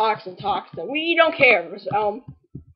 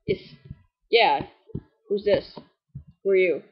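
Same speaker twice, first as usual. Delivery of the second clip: normal talking voice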